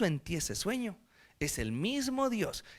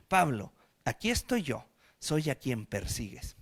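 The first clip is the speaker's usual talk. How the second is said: voice of the Lord; 6 semitones lower, 4 dB quieter than usual. Acted. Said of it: "se le aparece al Señor y le dice".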